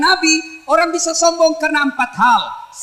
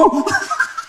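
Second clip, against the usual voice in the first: light laugh; 5 semitones higher than usual. Real, not acted. Laughs at his own joke.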